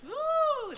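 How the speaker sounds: high, loud mock-shocked ooh; a playful gasp at his temper; ribbing him about his famous temper; teasing ooh. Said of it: "there's that famous Hollywood temper".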